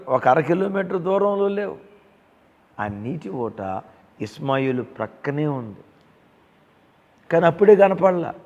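loud sermon exhortation; preaching. Acted. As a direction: press the promise home to the flock. Pastor preaching to congregation.

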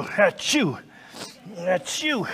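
mock sneeze into the mic; sneezing. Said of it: "excuse me while I, uh, sneeze".

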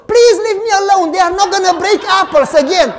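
high, loud mock wailing; hammed-up scream for mercy; fake screaming. Boy on the couch faking screams so others hear. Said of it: "Pizzi would scream from the couch".

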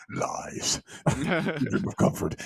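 slow, croaked mock dark-lord voice; hammed-up menacing god, cracking into a laugh; sub-bass villain. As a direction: draw it out in a hushed cinematic villain rumble. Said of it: "super heavy sub bass".

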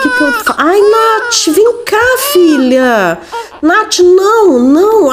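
high-pitched, play-acted motherly alarm; mock-frantic mother pleading. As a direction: call the child back, voice jumping up high. Frantic mother calling her child back.

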